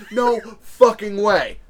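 retelling his shock at live maggots; stunned disbelief. Retold or imitated. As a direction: spell out each word in disbelief. Retold disbelief, maggots survived bleach.

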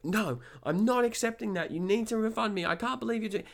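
high, insistent imitation of a furious customer; really agitated. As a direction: mimic her refusing to back down. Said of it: "she's getting really agitated now".

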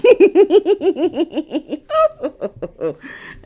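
high, loud burst of nervous giggling; nervous laugh. Shown as a demonstration. Masseuse showing her embarrassing nervous laugh, a high loud giggle. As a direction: let out an awkward, helpless giggle. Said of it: "my nervous laugh is like".

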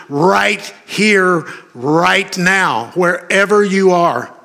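high, insistent preacher's exhortation; fervent preaching. Real, not acted. Preacher assuring the struggling that God is present, fervently.

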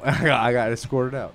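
usual speaking voice

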